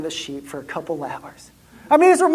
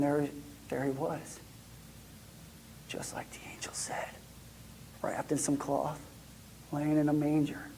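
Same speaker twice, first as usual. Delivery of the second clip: soft, near whisper, stunned by the sight; shepherd sees the baby in the manger, hushed; hushed awe; 6 semitones lower, 17 dB quieter than usual. Acted. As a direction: breathe it out as if afraid to wake him.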